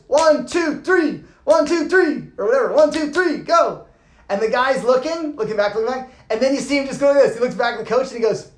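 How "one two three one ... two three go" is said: high loud urging countdown; coach's count. Retold or imitated. Coach counting a frozen jumper out the door, loud. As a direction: push him out with the count. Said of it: "And the coach goes".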